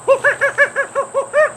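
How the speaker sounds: high squeaky monkey laugh; gleeful monkey chatter; monkey giggle. Acted. George laughs at the hard tent, high monkey giggle.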